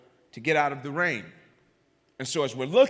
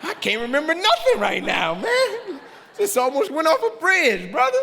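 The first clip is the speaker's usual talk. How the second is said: high, loud comic shout; hammed-up incredulous yelling; exasperated outburst; 13 semitones higher, 6 dB louder than usual. Acts out his exasperated inner retort.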